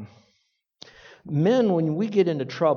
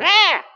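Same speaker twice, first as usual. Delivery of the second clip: sharp high mock scream of a hurt kid; child's scream; 8 dB louder than usual. Parent hearing a kid hurt, high mock scream. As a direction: let out a shrill imitated child's wail. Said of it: "one of your kids all going".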